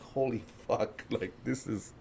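slow, hushed amazement; stunned awe. Retold or imitated. Stoned teen marvels at the first hit, stunned and hushed. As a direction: let it out softly and slowly, dazed.

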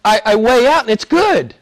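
a hint of a smug believer; self-righteous churchgoer. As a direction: lightly play someone sure of their own goodness. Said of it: "people have this image".

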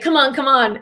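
high, eager mock pleading; imitated enthusiasm. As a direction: imitate an excited fan urging someone. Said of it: "he was so enthusiastic about it".

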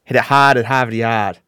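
hammed-up Boston accent, words stretched. Shown as a demonstration. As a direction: draw out the vowels in a mock Boston accent. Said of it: "your cousin from Boston".

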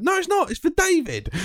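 put-on a drunk mate digging in, high and daft; drunk insisting. Drunk friend insisting, voiced.

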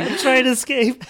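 giggly mock protest; laughing. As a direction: crack up while saying it. Repeats line laughing.

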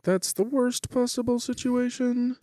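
thin, very high mock squeal; high-pitched dread. Stoner dreading dad getting woken, high-pitched dread. Acted reaction to waking dad.

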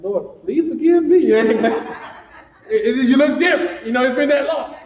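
laughing, high and loud; laughing apology. Real, not acted. Laughing at not recognizing her.

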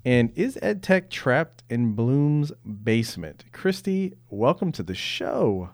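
upbeat podcast intro read; radio announcer. Acted. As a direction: announce the show over music. Podcast host's music intro read.

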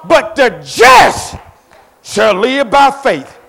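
loud, high, slow preaching with shouted names; preaching at full force. Acted.

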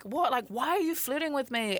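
high-pitched, confused protest; a little upset. Retold or imitated. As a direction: demand an explanation, mildly upset. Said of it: "got a little bit upset".

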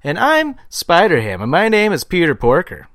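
deadpan standup impression; John Mulaney impression. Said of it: "my John Mulaney impression".

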